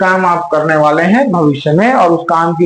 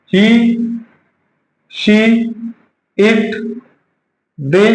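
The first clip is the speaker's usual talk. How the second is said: one word at a time, long pauses between; dictating word by word; much slower than usual. Shown as a demonstration. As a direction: dictate slowly for note-taking. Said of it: "इसको आप लिखिए".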